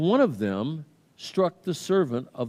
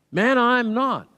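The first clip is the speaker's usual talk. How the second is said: higher, louder acted denial; emphatic denial; 10 semitones higher, 7 dB louder, slower than usual. Peter answers the one accusing him, emphatic denial. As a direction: insist loudly, pitched up, as Peter denying it. Peter denies being a disciple.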